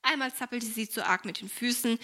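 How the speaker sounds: usual speaking voice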